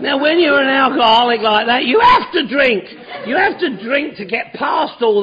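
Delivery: emphatic. Real, not acted. Preaching point, raised voice.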